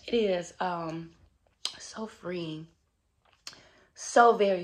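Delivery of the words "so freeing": whisper. Real, not acted. Intimate reflection, drops to whisper.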